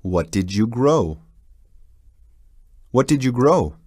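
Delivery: ordinary talk, nothing marked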